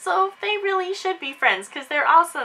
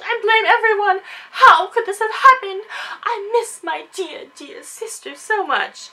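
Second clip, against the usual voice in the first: mock grieving Emily; 5 semitones higher than usual. Emily's fake grieving voiced.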